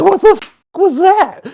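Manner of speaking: high, loud startled yelp; jolted, shocked cry, way up high; stunned monster. Retold or imitated. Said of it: "And he was like".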